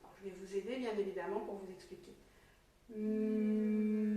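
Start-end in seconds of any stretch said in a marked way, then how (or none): 2.9-4.1 steady, sustained humming M sound; long held "mmm". Shown as a demonstration. Teacher lets pupils hear the first sound, long held "mmm". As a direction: hold the letter M on one level note. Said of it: "on va essayer d'écouter à l'intérieur de cette syllabe".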